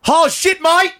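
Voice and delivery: high, loud Aussie-Cockney yell; muddled Australian-Cockney accent. Shown as a demonstration. Voice actor does game callouts on request, shouted Australian-Cockney accent. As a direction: holler it in bad Australian-Cockney. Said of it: "I want you to use that accent".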